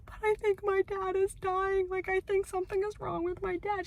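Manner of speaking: put-on high, small pleading child voice; imitating her younger self begging. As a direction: plead as the scared little kid. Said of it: "And I'm just like".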